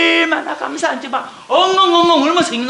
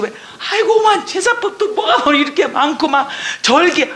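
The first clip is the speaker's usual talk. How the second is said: ordinary delivery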